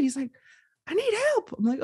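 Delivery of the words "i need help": very high imitated plea; mock scream. Screaming man answering a passerby, mock yelled plea. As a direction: act out a desperate yell at normal volume. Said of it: "he's just screaming at nobody".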